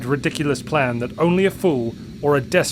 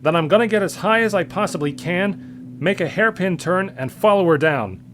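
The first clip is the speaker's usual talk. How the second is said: determined; 7 semitones higher, 3 dB louder than usual. A narrator's reading. Scott's desperate plan.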